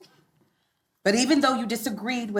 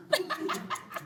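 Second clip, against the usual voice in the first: breathy giggle; 12 semitones higher, 10 dB quieter than usual. Acted. Laughing at her own plan.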